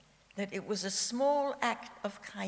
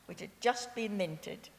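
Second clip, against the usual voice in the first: normal talking voice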